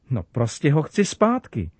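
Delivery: plain reading voice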